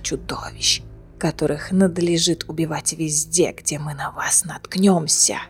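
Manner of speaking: soft, low, breathy whisper with a throat injury; broken hoarse whisper. A narrator's reading. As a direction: whisper through a ruined throat. Dying prisoner taunts Manon.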